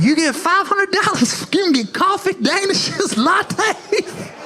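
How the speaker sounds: high, far-off hype voice; cheesy announcer. Retold or imitated. Arena announcer teasing the wife, hyped and high. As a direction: sell the prize way up high like a game host.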